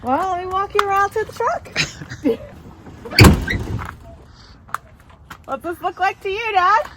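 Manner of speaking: playful high teasing tease; teasing reveal. Real, not acted. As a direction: build up the surprise playfully. Teasing dad on video call.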